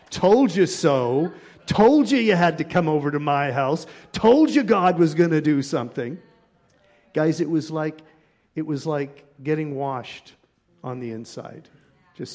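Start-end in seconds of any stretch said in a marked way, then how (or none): 0.1-6.2 loud, crowing I-told-you-so; gloating dad. Retold or imitated. Dad standing over the laughing visitor, gloating loudly. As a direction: boom it out triumphantly, stretching the opening words. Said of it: "her dad is standing there like".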